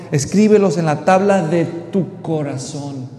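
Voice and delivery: loud, slow declaiming of the verse; preacher booming the closing line of the verse; slow proclaimed verse. Acted. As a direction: lay down the command with weight. Preacher proclaims then echoes verse.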